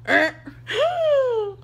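very high, laughing gasp; shocked gasp. Retold or imitated. Stylist cutting above the ear, a very high gasp. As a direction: gasp in mock horror.

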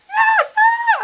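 very high, loud screaming cry; fangirl shout. Retold or imitated. Fan screaming love at the idol Sam. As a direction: scream it at the stage. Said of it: "I'm like".

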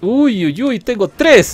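high, loud whoop of delight; excited exclamation. Real, not acted. Player discovering three targets at once, excited whoop. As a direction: whoop at a lucky catch.

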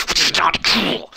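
slow, high-pitched squeezed delivery; mock strain. Reading a reply about a stroke, mock strained. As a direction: stretch it out high, hammed-up.

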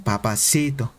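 low, imitated Colombian "Papacito"; Colombian accent. Streamer showing how Colombian women say it, imitated Colombian accent. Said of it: "las colombianas, ¿cómo lo dicen?".

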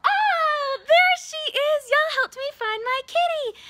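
put-on a big thrilled find for the kids; excited discovery. Finding the cat for children.

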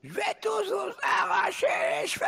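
very high shrieking monster threat; screeching monster. Pretend. (cri de monstre suraigu)

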